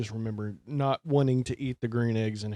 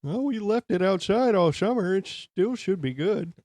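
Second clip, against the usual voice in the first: mock cafeteria worker; 9 semitones higher, 5 dB louder than usual. Voicing school staff.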